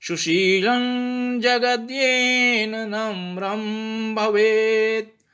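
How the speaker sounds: slow, drawn-out Sanskrit chant-like reading; slow Sanskrit recitation. Shown as a demonstration. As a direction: recite each syllable slowly and clearly. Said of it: "तो ये भी मैंने बताया".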